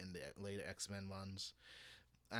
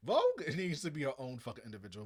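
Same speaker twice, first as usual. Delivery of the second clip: exasperated; 4 semitones higher, 8 dB louder than usual. Retold or imitated. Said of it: "it's like".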